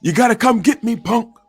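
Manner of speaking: high, loud taunting shout; full-throated holler through a door; yelled. A narrator's reading. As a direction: yell it back as a challenge. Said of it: "So I yelled".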